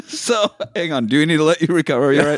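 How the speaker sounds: wincing laugh from a sore wound; laughing through pain. Real, not acted. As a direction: laugh while it hurts. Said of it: "it hurts to laugh".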